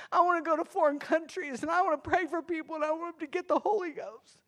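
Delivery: put-on high, childlike voice of a small girl praying; little girl praying. Preacher voicing her child self praying to Jesus, high and childlike. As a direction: pray like a sincere nine-year-old girl. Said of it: "little nine-year-old chubby freckle-faced girl".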